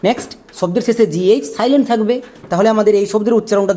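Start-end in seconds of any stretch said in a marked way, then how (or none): none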